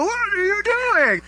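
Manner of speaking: high, strained, drawn-out scolding; mock parental scream. Parent scolding a child who made a scene, mock scream. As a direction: stretch it out on a high, held pitch. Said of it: "have to scream at us".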